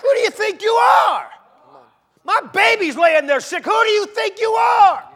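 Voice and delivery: shouted high, a furious parent demanding answers from God; outraged challenge. Acted. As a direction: scream "who do you think you are" in defiance. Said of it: "the gall some people have when they're dealing with God".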